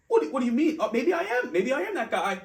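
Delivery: high, loud mock surprise; flattered surprise. Imagining being called charming, high mock flattered surprise. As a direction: act stunned then pleased with yourself. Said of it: "Me, I'm just like".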